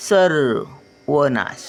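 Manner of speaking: सर्वनाश drawn out syllable by syllable; slow syllable reading. Shown as a demonstration. Grandmother sounding out सर्वनाश for children, slowly. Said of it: "word then slow repeat".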